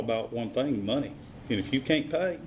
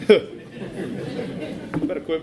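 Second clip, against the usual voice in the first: laughing; 3 semitones higher, much slower than usual. Real, not acted. Laughing at his own excuses.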